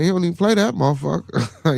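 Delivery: usual speaking voice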